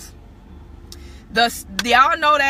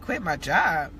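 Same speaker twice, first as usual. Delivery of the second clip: low, incredulous echo of an order; retold disbelief; 4 semitones lower than usual. Believer answering God's order to quit, retold disbelief. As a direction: question it in mock disbelief, pitched down. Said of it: "And I'm like".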